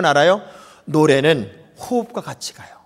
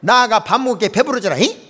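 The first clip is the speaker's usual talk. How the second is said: Jeolla dialect; 4 semitones higher, 4 dB louder than usual. Retold or imitated. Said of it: "전라도 말은요".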